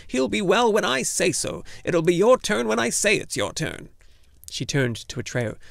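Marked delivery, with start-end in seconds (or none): 0.1-3.9 high, loud, domineering crone; bossy old gnome woman. A narrator's reading. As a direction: lay down the law as the tiny healer. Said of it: "the little woman hissed".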